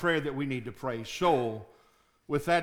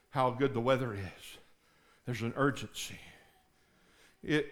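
ordinary talk

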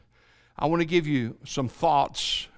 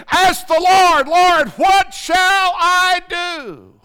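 much higher and louder, crying out to God; shouted plea; 13 semitones higher, 9 dB louder, slower than usual. Acted. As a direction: call it out, slow on the prayer. Preacher cries out to God.

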